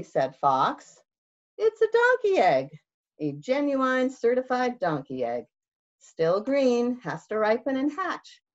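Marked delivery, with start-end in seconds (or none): 1.6-8.4 high, eager sales pitch; fast-talking salesman fox. Acted. Fox selling the egg to Bear, higher salesman pitch. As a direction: sell it like a huckster.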